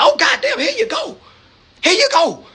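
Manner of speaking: suara jauh lebih tinggi, pura-pura girang; senang pura-pura. Retold or imitated. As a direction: seru melengking seperti gembira menyerahkan uang. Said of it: "And I said".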